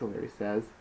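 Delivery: ordinary talk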